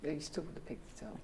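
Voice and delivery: breathy hushed whisper. Real, not acted. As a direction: whisper so the recorder misses it. Hushed remark while recorder runs.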